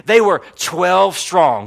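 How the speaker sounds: normal talking voice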